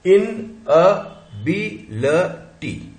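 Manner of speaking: इन अ बी ल टी very slowly, a gap after each; syllable by syllable. Shown as a demonstration. Teacher building the word for counting, syllable-by-syllable demonstration. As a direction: sound out इन, अ, बी, ल, टी separately. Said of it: "एक, दो, तीन".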